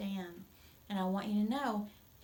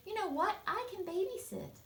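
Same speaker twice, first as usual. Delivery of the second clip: much higher, bright offering voice; eager girl; 11 semitones higher than usual. Acted. Olivia offering to babysit, high and eager. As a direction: pipe up like a keen girl.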